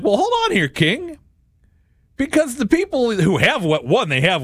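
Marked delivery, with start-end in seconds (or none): 0.0-1.2 much higher, disbelieving hold on there; high, indignant protest to a king; incredulous objection. Retold or imitated. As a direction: challenge the king in mock disbelief. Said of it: "I'm like".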